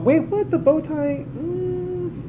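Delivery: squeaky high question, then a high hum; surprised. Real, not acted. Streamer spotting a bow tie, surprised high squeak. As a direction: pitch jumps way up in disbelief.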